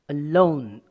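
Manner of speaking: a-LONE, loud on the second syllable; weak "A", strong "lone". Shown as a demonstration. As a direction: hit "lone" hard in "Alone", keep the A weak. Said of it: "a is a weak form".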